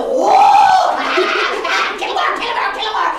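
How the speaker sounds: high, loud hammed-up shriek; mock scary bird shout. Imitating the birds' scary noises, hammed-up shout. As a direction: yell it like a menacing bird.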